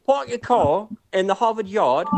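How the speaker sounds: attempted Boston that comes out British; British accent. Shown as a demonstration. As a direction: draw it out like a Brit. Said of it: "That's a British accent".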